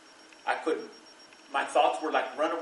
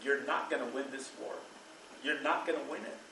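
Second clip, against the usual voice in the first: quiet warning; 2 semitones lower, 8 dB quieter than usual. Retold or imitated. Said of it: "I would tell them".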